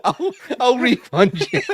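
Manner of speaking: high, slow words through laughter; real helpless laughter; laughing. Real, not acted. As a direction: struggle to repeat it while cracking up. Laughing at the refund offer.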